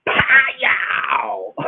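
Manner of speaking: high, drawn-out "pow"; long mock-impact yell; imitated slap. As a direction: shout out a cartoon smack. Imitates grandmother's hard slap.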